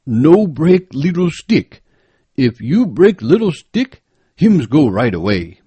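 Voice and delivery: a guide stressing each warning word; cautious African guide. A narrator's reading. Guide voice, held.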